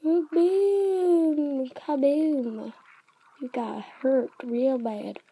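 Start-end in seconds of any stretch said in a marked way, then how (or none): 0.1-2.7 loud, high, stretched-out explosion words; gleeful battle cry; triumphant booms. Real, not acted. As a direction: shout each hit like a blast. Landing hits on the crab.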